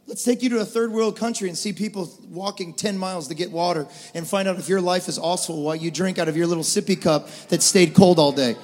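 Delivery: normal talking voice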